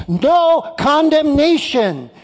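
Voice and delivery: slow, weighty No condemnation with No stressed; emphatic no. Shown as a demonstration. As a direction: lean hard on No condemnation, slowly. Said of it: "very direct and very dyn-, dynamic and very emphatic".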